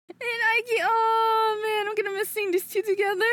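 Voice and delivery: high, tearful whine; on the verge of tears, pitched high; tearing up. Real, not acted. Streamer missing seeing two favorites together, tearing up. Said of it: "I'm tearing up a little".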